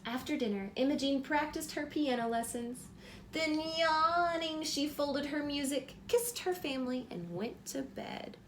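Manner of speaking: bright, lively storybook reading; reading to children. A narrator's reading. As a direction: read it like bedtime story time for a little one. Reads picture book aloud.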